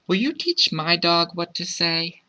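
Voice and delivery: put-on high child's voice asking for help; high, singsong child's request; child calling out. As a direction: ask sweetly in a high kid's voice. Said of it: "the child called out".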